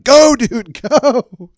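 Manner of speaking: high, loud panicked shout with a laugh; yelling go at the driver; frantic yell. Retold or imitated. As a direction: scream at him to drive. Fleeing in stranger's car.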